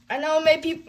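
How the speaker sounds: ordinary talk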